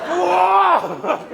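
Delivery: a shocked whoa, like you just got hit; stunned whoa. Real, not acted. Son's cheeky remark about mom.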